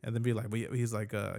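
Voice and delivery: ordinary talk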